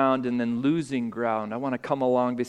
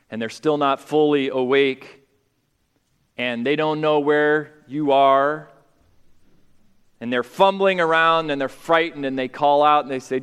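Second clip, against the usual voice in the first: usual speaking voice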